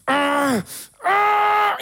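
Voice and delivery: loud high yelps of pain; pained cry. Retold or imitated. Retelling the trick, a loud mock cry of pain. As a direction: yell out like it burns. Mimics man's painful cry again.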